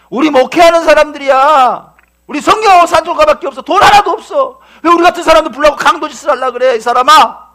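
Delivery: loud, high, pleading rebuke; acted-out outraged protest; indignant scolding. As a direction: tell off an attacker at full voice. Pastor's wife scolds the robber.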